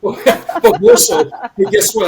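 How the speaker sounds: high, loud laughing burst; slow, loud laugh of disbelief. Real, not acted. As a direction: break into a breathless laugh at the absurdity. Laughs at unlikely future.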